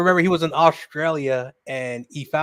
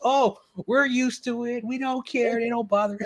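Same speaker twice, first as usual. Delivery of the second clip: mock locals; 8 semitones higher than usual. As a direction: imitate easygoing locals, pitched way up. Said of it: "the locals".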